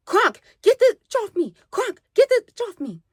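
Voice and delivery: high whiny cry for help; mock panicked plea. The girl pleads with Crunk to help her, high whiny mock plea. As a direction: beg Crunk to pull her off. Girl begging mid-fight.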